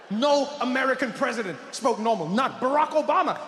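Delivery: ordinary talk, nothing marked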